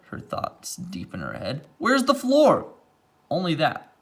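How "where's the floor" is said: mother asking. A narrator's reading. Mother voiced in read story.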